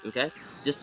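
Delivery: ordinary talk, nothing marked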